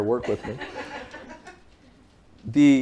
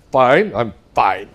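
"Fine, I'm fine" said slow and louder, each "fine" pressed out tight; mock curt fine; 7 dB louder, much slower than usual. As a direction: drag out "Fine, I'm fine" like a wife who is anything but fine. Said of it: "She had "fine" in her voice".

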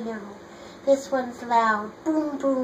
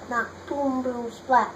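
usual speaking voice

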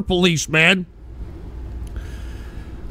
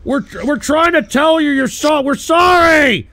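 high-pitched, stumbling plea rising to a shout; urgent shouted apology, stretched out at the end; desperate pleading; 7 semitones higher than usual. Real, not acted. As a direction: beg frantically, voice pushed way up. Begs her to stay, apologising.

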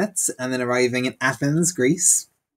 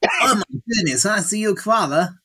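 high-pitched, hammed-up Australian accent; 7 semitones higher than usual. Shown as a demonstration. Guest showing off his accent on the host's request, exaggerated Australian. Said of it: "give us your best, uh, Australian accent".

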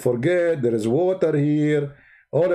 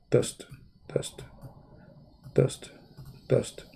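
whisper; 2 semitones lower, 8 dB quieter, slower than usual. Acted. Whispers the word while drawing.

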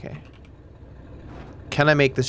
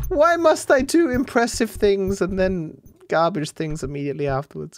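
self-mocking griping; mock lament; 5 semitones higher than usual. Real, not acted. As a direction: whine about your own bad luck. Complaining about own failure.

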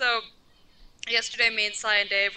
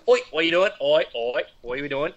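put-on Australian accent, scolding a dog; Australian accent telling off the dog; Australian yelling at a dog; 7 semitones lower than usual. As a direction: bark orders at a dog like an Aussie. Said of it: "If you were yelling at a dog, go".

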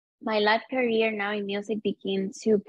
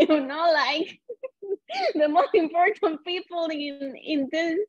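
high, giggling mock cheer; real laughter over a mock yay, much higher; laughing excitedly; 8 semitones higher than usual. Real, not acted. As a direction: laugh through it, pitched way up. Laughing at her own mock excitement.

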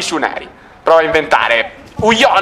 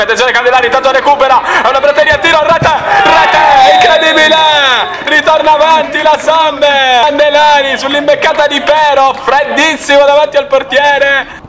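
breathless italian goal commentary; 7 semitones higher than usual. Real, not acted. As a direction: scream the goal with full force. Goal call, live commentary.

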